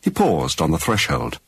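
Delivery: ordinary talk, nothing marked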